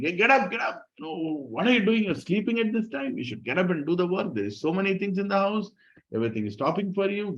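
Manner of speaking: a hint of a shrill, bossy in-law; scolding mother-in-law. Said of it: "her mother-in-law starts shouting".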